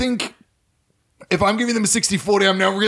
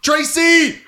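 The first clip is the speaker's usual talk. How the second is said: calling for help; 6 semitones higher than usual. Acted. Injured man calling for help.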